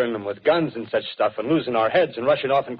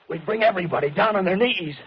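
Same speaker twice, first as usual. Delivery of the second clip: zealous boast; 9 semitones higher than usual. Acted. Artilleryman's power fantasy peaks.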